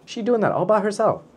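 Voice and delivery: put-on high-pitched baby-talk praise; proud cooing. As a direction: coo over it as if it were a toddler. Praising book standing up alone.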